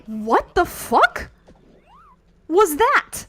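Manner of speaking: slow then high loud disbelief; stunned outburst. Real, not acted. Streamer shocked by sudden game event, stunned outburst. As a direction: react in shock at the screen.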